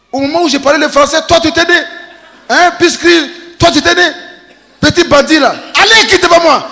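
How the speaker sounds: high, loud mock scolding; scolding father. Father scolding his son, high loud mock anger. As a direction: chase the cheeky kid away.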